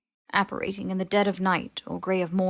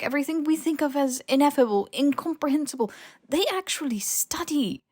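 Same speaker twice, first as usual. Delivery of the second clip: higher, rushing, breathless with wonder; manic excitement; 8 semitones higher, 3 dB louder than usual. A narrator's reading. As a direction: gush about the hidden world as if justifying it. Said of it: "She sounded a bit manic".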